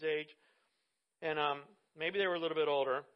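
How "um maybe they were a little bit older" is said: ordinary talk, nothing marked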